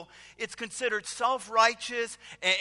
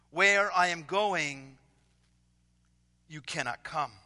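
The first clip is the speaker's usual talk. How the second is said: scripture reading. Acted. Reads Jesus' words in scripture.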